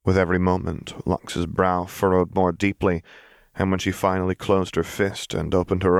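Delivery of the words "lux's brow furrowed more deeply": usual speaking voice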